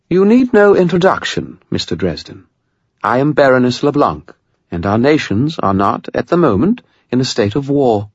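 high, formal British baroness; precise British accent. A narrator's reading. Vampire baroness addresses wizard Dresden, precise British accent. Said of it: "in a precise British accent".

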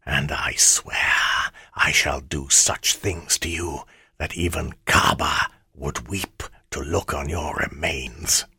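menacing shadow spirit, hushed; dark whisper behind you. Acted. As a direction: breathe threats like a hungry shade. Ammit's threats as shadow voice.

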